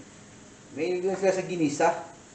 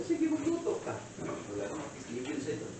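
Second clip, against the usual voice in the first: soft teasing aside; 12 dB quieter than usual. Retold or imitated. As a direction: toss it off very softly. Retold teasing reply, first take. (mahinang pabirong sagot)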